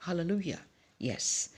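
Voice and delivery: ordinary talk, nothing marked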